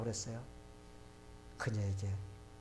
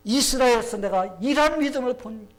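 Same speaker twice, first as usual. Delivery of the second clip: loud, high exclamation of wonder; forceful acted amazement; amazed admiration; 7 semitones higher, 11 dB louder than usual. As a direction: proclaim it, astonished by what you found. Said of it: "하면서 예수님께서 얼마나 감탄하셨습니까".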